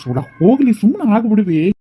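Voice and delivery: high, exasperated plea; fed-up outburst. Acted. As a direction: throw up the argument in a high, strained cry. Son gives up on the quarrel.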